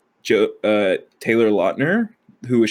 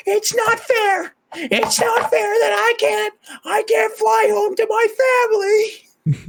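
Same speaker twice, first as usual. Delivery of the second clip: super high-pitched yelling. Retold or imitated. Host imitating man yelling at plane, super high-pitched voice. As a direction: squeal it like an outraged complainer. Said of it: "super high-pitched voice".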